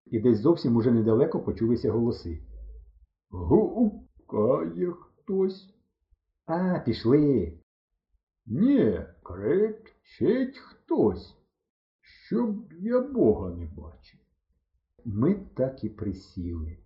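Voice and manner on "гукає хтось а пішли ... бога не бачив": very slow, drawn-out tipsy drawl; slurred drunk talk; drunken villagers. A narrator's reading. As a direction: drag each word out, swooping up in pitch. Drunk villagers' distant dialogue, acted.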